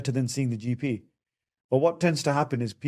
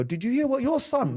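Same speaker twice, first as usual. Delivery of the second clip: much higher, indignant mother appealing to the father; wife complaining to her husband about their son, high and indignant; outraged mother; 12 semitones higher than usual. Retold or imitated. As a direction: tell on the child in a shocked high voice. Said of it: "And they'll go".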